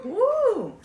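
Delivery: a high, loud whoop; excited cheer. Real, not acted. Host hyping the show intro with a loud whoop.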